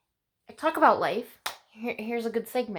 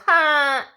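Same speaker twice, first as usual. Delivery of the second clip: loud ha; 6 semitones higher, 10 dB louder than usual. Real, not acted. Mock self-cheer after "bisexual.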